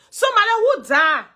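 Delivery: usual speaking voice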